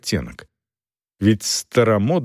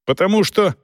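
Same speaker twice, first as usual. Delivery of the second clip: blurted; 8 semitones higher than usual. A narrator's reading. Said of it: "выпалил Сэлдон".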